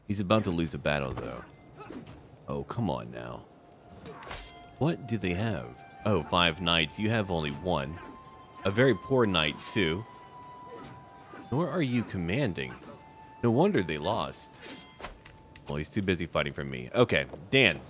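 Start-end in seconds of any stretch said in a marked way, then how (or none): none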